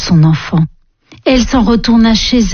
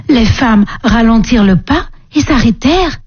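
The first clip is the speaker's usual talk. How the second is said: ordinary delivery, nothing marked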